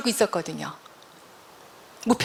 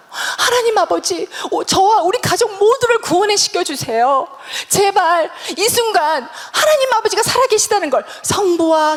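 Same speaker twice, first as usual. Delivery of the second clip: fervent prayer; 7 semitones higher, 3 dB louder than usual. Retold or imitated. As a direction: plead urgently for the family's salvation, re-enacted. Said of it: "그러면서 막 기도를 했어요". (높은 목소리로 절박하게 부르짖는 기도)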